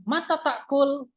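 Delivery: mata takul slow, pitched high as a question; question tune. Shown as a demonstration. Teacher showing students a question, slow and high. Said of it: "berarti itu kan tanya".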